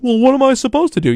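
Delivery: high, whiny mock innocence; mock player excuse. Host mocking a player who kept jumping offside, high whiny protest.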